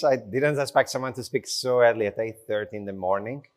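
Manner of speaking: ordinary delivery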